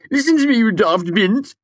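high, loud mock-furious Vintish accent; Ambrose imitation, Vintish accent. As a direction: mimic a scowling noble's Vintish accent, shouting. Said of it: "fair imitation of Ambrose's vintage accent".

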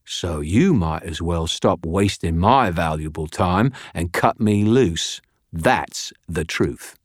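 lightly voiced smug British tough, slowing at the end; cocky crook, smug. Prisoner telling captors to cut him loose, smug. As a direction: lean on it smugly, ending slow.

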